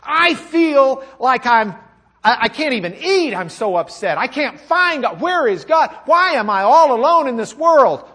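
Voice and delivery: loud, high, pleading outburst; acted anguish. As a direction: preach the psalmist's despair at full voice. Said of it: "This is how I feel".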